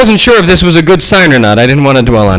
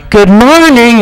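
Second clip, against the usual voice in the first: mock-sweet, higher-pitched greeting; sweet sister voice; 5 semitones higher, much slower than usual. Sweet voice sister greeting you at the center, sugary. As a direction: sing out a sugary hello in imitation. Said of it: "sweet voice sister going".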